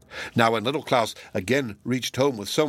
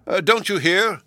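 host yelling, higher and louder; loud cry; 8 semitones higher, 5 dB louder than usual. Acted. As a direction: call out as if to someone deaf. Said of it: "cried the host as loud as he could".

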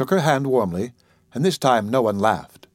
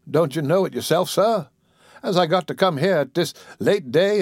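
put-on higher old woman's voice, scolding fondly; chiding old auntie; 4 semitones higher than usual. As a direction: tease him like the child you raised. Dicey reproaches Bobby.

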